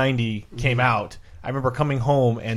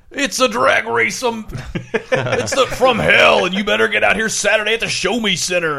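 agitated ad announcer; 8 semitones higher than usual. Retold or imitated. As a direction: bark it like a local radio promo. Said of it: "the agitated man threatening you".

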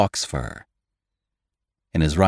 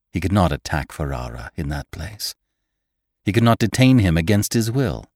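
plain reading voice

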